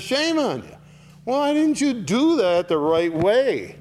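high, loud scolding; rebuke. Acted. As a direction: cry out in reproach. Preacher rebukes congregation.

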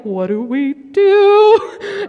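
high, slow, stunned question; drawn-out helpless panic; panicked bystander. Retold or imitated. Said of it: "me and Elizabeth are like".

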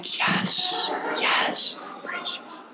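whispered, breathless relief; inner whisper. Retold or imitated. Pastor's hidden inner relief, whispered. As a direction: hush it like a secret cheer to yourself. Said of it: "inside, I'm going".

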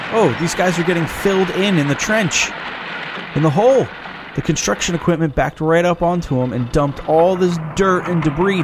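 worked-up shocked narration; alarmed. Real, not acted. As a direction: blurt it out as the horror unfolds. Reacting to death scene.

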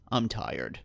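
tired. Acted. Roscoe alone with the party after the talk, tired. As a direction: let it drop, worn out. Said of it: "he looks both tired".

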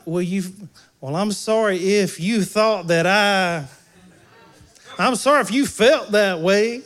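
fake apology. Shown as a demonstration. Pastor mocking a fake apology to congregation, mock defensive. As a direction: ham up a defensive half-sorry. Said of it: "It's not".

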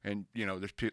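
ordinary talk

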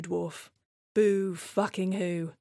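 ordinary talk, nothing marked

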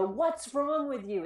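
slow, pointed accusation; accusing. Shown as a demonstration. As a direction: say it judging the child. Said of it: "feel a little aggressive and attacking and judgmental".

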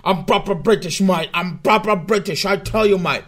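loud, hammed-up mock British accent; over-the-top mock British accent, pipe in mouth. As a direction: bellow it like a stage British bloke with "mate" on every line. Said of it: "I'm using very British terms".

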